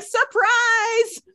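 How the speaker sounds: high, sing-song surprise; mock surprise. Mocking the show's writers springing a twist, sing-song. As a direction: tease the writers with a cheeky reveal. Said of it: "so on brand for them to be like".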